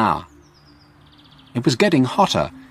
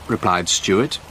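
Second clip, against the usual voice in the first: ordinary delivery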